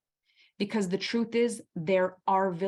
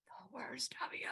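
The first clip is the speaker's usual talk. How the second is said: breathy unvoiced whisper; 3 semitones higher, 17 dB quieter than usual. Real, not acted. Telling the audience about lost tempers, whispered. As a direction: drop to a secret whisper. Dramatic aside about losing it.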